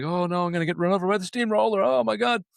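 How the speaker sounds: retold movie victim, rising into mock panic; mock panicked screaming. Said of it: "this guy's just standing there screaming".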